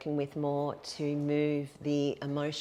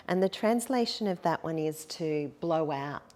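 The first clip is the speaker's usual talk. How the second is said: normal talking voice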